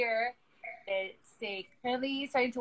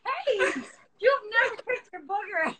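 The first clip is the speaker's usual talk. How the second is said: put-on high, playful baby talk to the child; 9 semitones higher than usual. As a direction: coo at the baby, teasing. Mother teasing her baby.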